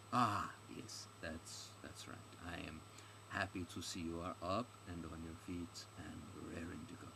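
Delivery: low, soft, hesitant character voice; halting foreign guide. Acted. Guide greeting the recovered stranger, halting and soft. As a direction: play a polite guide feeling for words.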